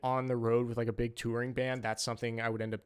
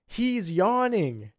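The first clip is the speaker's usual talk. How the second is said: much higher, louder, very slow tattling voice; imitating the bride pointing him out; mock bride accusing; 7 semitones higher, 7 dB louder, much slower than usual. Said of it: "points and goes".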